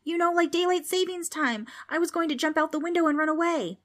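high-pitched, whiny excuse; shouting back. A narrator's reading. Preston explains his escape plan, shouting back. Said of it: "Preston shouted back".

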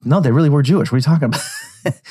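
high-pitched mock protest. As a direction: imitate an insistent objection, voice pitched well up. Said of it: "And [name] is like".

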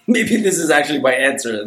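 says it through a laugh; a real amused laugh while speaking. Real, not acted. Laughing at own idea.

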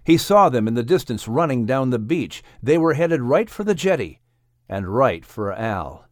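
plain reading voice

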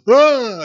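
a sudden high, loud hammed-up "Ah!"; mock yelp. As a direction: yelp like a bad actor going down. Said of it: "and the guy goes".

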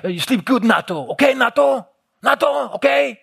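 high-pitched, goading call across the table; imitating an angry brother's heckle; storebror's taunting. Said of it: "Så han sidder hele tiden og siger".

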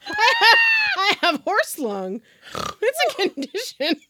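wheezy giggling talk; breaking character giggling. Real, not acted. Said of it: "You sound like you ran a mile".

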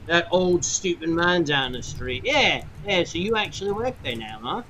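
mocking her new job, half-drunk; drunk sailor. Drunk gentleman teasing Aradia about the shop, light tipsy sneer. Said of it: "you can smell the ale upon his breath".

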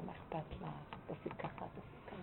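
very soft, nearly whispered advice; hushed coaxing. Retold or imitated. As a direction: coax her quietly, confidentially. Gently coaxing the calmed wife. (שידול שקט, כמעט בלחש)

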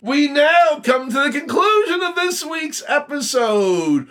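host closing the show, in a grand shouted announcer voice; radio announcer. Pretend. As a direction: boom it out like a big radio host. Mock radio sign-off again.

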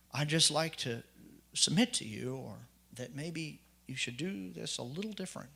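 gentle correction. Retold or imitated. Church brother carefully correcting the new man, gentle and soft. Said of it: "he would say something to me like this".

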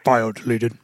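flat, low robotic announcement. Retold or imitated. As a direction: read it like a computer. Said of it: "And the robot voice goes".

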